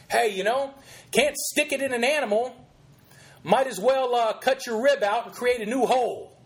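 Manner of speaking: mock college student. Host voicing what a liberal college student would think, mocking. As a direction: toss it off as the student's crude takeaway. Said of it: "this is what that young college, liberal college student would think".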